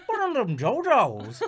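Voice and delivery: put-on high, loud bayou cook with a thick accent; thick Southern accent. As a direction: garble it like a Southern chef. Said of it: "a real thick accent or something".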